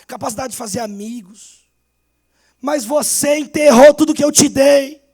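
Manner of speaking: loud rising preacher's appeal; preaching at full force. Acted. Pastor voicing the master then calling the church, full-force preaching. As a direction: plead and build to the close.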